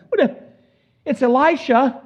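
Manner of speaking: the king stammers as he recognizes Elisha; baffled king. Acted. King spots Elisha leading army.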